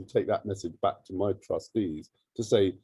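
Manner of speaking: ordinary delivery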